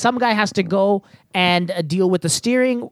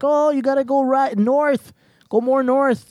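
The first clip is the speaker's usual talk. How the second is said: higher, slow, half-hearted yell of directions; mock yelling directions; 6 semitones higher, slower than usual. Player at the table calling directions to the crew, mock yell. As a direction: call out the heading like a crewmate at the table. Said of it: "at the table yelling".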